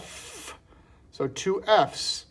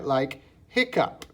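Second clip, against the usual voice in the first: ordinary talk, nothing marked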